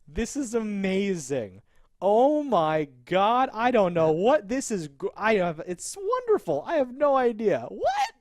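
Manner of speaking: overwhelmed and delighted, spilling it out; mock amazement. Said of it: "Carol's reaction is like".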